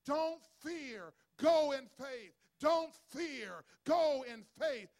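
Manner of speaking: loud declaration, each line hammered; proclaiming. Acted. Woman's word to the struggling couple, loud proclamation. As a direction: cry it out as a word of faith. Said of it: "the word the woman spoke".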